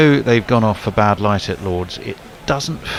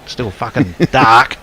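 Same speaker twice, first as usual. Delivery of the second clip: slow, lightly Australian grumble through a laugh; a hint of an Aussie umpire, laughing; light Aussie accent; 4 dB louder, slower than usual. Mimicking the Aussie umpire peering at the dark sky, in a light Australian accent. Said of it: "He'd be an Aussie".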